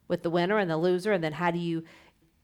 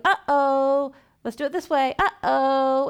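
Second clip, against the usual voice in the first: playful uh-oh; 6 semitones higher, 6 dB louder, slower than usual. Pretend. Gentle feedback to a child.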